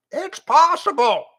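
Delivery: high squawk of a bird. A narrator's reading.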